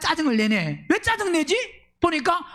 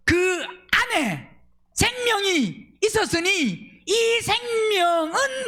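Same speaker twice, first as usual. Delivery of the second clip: solemn scripture reading; 6 semitones higher, much slower than usual. Acted.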